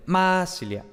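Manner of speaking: "masilia" as MAAA-silia, a big long first syllable; exaggerated initial accent on "masilia"; stress on first syllable. Shown as a demonstration. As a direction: overdo the MA in "masilia", let "silia" slacken. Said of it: "un acento tan grande en la primera sílaba".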